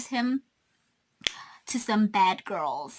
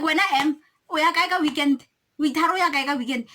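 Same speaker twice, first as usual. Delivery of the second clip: louder, higher, pushing the question hard; retold confrontation; 5 semitones higher, 5 dB louder, faster than usual. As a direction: demand an answer, voice raised. Confronting boyfriend about the trip.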